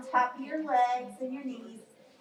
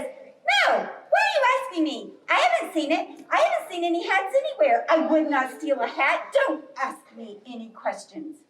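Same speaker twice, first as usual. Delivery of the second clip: defensive rabbit; 3 semitones higher than usual. Acted. Rabbit denying to bear that it saw a hat, flustered and indignant. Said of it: "And the rabbit says".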